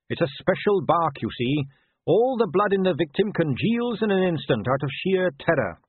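a hint of Carrot, innocently explaining; earnest young watchman.

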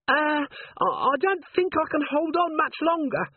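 very high, strained little voice; frightened dwarf corporal. A narrator's reading. Littlebottom dangles from the candle line, squeaking anxiously. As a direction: squeak it out while straining to hold on. Dwarf hanging over hot tallow.

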